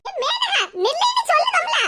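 high, exasperated calling; insistent call. Acted. Friend ordering Menaka to stop, insistent high call. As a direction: call the runaway to a halt.

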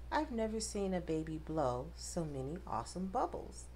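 plain reading voice

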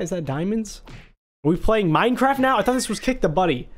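usual speaking voice

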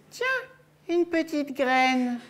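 very high, slow little hen voice; chicken voice. Acted. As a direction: speak as a dainty hen. Chicken voiced, offering seed.

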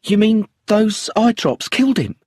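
high, stunned disbelief; shocked widow. Acted. Widow hearing the eye drops killed her husband, high shocked disbelief. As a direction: let the shock push the voice up. Brenda shocked by poisoning news.

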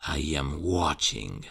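usual speaking voice

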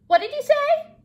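very high, loud cricket voice; high-pitched, shouted question; oblivious cricket. A narrator's reading. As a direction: squeal it loudly like a little cricket. Voicing the cricket, hard of hearing.

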